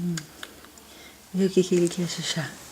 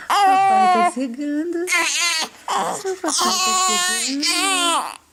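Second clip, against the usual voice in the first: sing-song; 9 semitones higher than usual. Real, not acted. Repeated higher cooing.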